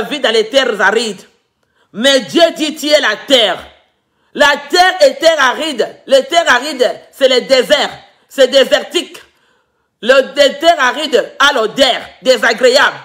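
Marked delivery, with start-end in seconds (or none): none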